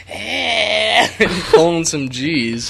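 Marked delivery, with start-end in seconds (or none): 0.1-1.1 high, drawn-out "ehhh" squeal; imitated yelp. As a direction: mimic a squeal going round the curve. Retelling his reaction on the curve.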